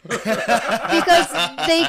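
laughing through the words. Real, not acted. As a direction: speak while breaking into a laugh. Laughing while telling a story.

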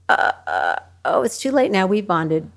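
ordinary talk

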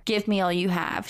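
ordinary delivery

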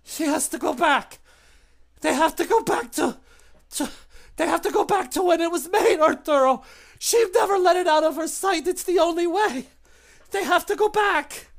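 very high and loud, pained and rushed; shrill desperate creature. Acted. The burnt creature desperately explains to Arturo, high and loud. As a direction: gasp it out after the torture. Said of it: "she's like".

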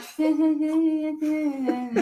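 high hummed filler for forgotten lyrics; wordless singalong. Retold or imitated. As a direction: fake the tune without words. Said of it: "I don't know any of those words".

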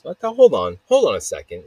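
winded mid-rant; short of breath, pausing. Real, not acted. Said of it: "Let me catch my breath here".

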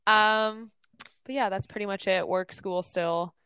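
usual speaking voice

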